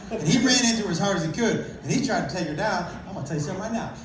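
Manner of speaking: usual speaking voice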